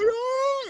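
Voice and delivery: very high, loud acted shriek; freaked-out scream. Jumper reliving the free fall, freaked-out scream. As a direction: let out a wild falling scream. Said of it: "freaking out".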